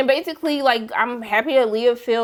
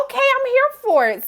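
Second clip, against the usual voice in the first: much higher, sassy approval; approving hype; 12 semitones higher than usual. Retold or imitated. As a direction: cheer the couple on, playfully. Cheering a kiss on screen.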